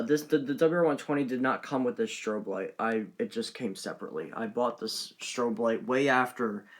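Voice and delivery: ordinary talk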